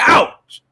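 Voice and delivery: very high, loud hammed-up yelp of pain; stubbed toe, comic shriek of pain; mock ouch yell. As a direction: yelp like you just stubbed your toe. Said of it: "And I was like".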